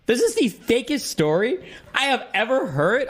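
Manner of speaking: high, squeaky words through real laughter; laughing in disbelief. Real, not acted. Streamer reacting to a fake story, helpless high laughter. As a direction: crack up while getting the words out, pitch way up.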